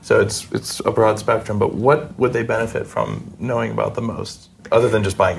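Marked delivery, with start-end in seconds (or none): none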